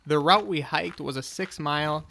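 ordinary talk, nothing marked

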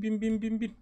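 usual speaking voice

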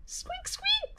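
tiny high squeaky mouse squeak; high soft mouse squeak. Acted. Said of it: "said Mouse".

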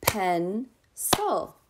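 Pencil slowly in two beats, PEN, pause, CIL; split into syllables. Shown as a demonstration. Teacher showing preschoolers syllables, Pencil split into two clapped beats. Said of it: "Clap it again with me".

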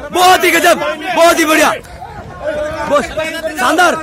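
usual speaking voice

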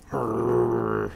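a low growl; rhino growl. Acted. Rhinoceros growls at Fluffy, low. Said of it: "said the rhinoceros".

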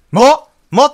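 sharp high, loud "も？"; startled gamer noticing something new. Real, not acted. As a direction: catch your breath at a surprise. Spots new item on screen.

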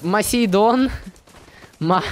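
ordinary delivery

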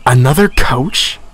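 can't believe the news; uneasy surprise. A narrator's reading. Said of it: "Thomas felt uneasy".

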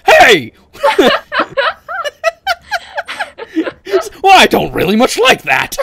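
loud, laughing mock British accent; a hammed-up British voice, cracking up; Markiplier impression. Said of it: "why he's British in this scenario".

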